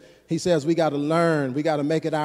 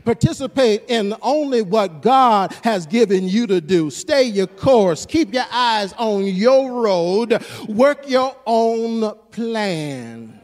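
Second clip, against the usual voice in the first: loud, rising sermon delivery, pushing each phrase out to the church; full-force preaching; 4 semitones higher, 4 dB louder than usual. Acted. Pastor drives the point home to the church in a loud preaching voice. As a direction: preach it like the congregation needs waking up.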